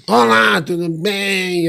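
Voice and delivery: hammed-up high whoop, last word long drawn out; high mock holler, slowing into a long tail; shrieked greeting. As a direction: imitate a gushing entrance, stretching the final word. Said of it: "do nada entra uma gritaria".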